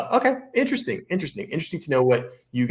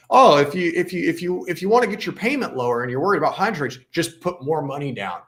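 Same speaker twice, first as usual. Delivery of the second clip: higher-pitched, quick imitation of pat advice; mock glib advice; 7 semitones higher, 3 dB louder than usual. Host mocking an article's advice on down payments, glib imitation. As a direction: mimic someone handing out an easy fix. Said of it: "This stuff like this is like".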